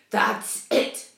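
loud exclamation from the captain; cried out. A narrator's reading. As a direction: cry it out in sudden realisation. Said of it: "cried the captain".

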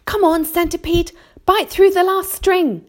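high, loud urgent command; giving an order. A narrator's reading. James orders centipede to bite the last string, urgent command. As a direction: call out a high, insistent order. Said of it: "James ordered".

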